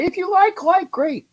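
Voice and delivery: if you like in a jumped-up high voice; high-pitched. Real, not acted. Repeats phrase, second time squeaky.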